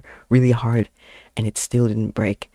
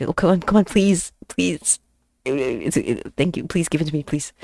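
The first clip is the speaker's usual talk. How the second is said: higher, wheedling retold plea; mock pleading; 5 semitones higher than usual. Student asks French teacher for broken chair, pleading. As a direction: beg like a student, lightly hammed-up.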